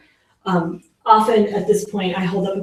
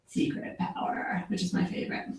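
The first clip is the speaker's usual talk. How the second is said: secret whisper; 2 semitones lower, 14 dB quieter than usual. Pretend. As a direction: whisper it like a secret you're letting them in on. Revealing the secret superpower.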